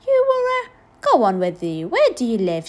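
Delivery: put-on loud, high incredulous old woman; brisk, disbelieving exclamation; loud disbelief. A narrator's reading. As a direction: scoff loudly, then question the child. Joan reacts in disbelief to boy.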